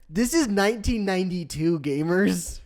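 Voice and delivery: mock hype announcement; hyped gamer shout. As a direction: play up the awe like a streamer. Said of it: "I'm just like".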